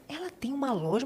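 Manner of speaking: a soft, breathy half-whisper of disbelief; mock-whispered surprise to herself; retold whisper. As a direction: whisper it as if sharing a secret surprise. Retelling her own surprised reaction.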